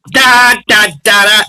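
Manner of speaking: high, loud chanted da da; Bonesy's saying. Retold or imitated. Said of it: "Bonesy has this little saying he says".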